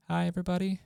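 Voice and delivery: high, thin stage greeting; timid presenter. Shown as a demonstration. Presenter on stage greeting the audience, high and timid. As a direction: squeak it out nervously. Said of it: "If I'm on stage and I'm giving a presentation".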